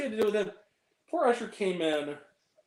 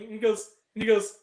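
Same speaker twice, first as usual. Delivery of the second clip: ordinary talk, nothing marked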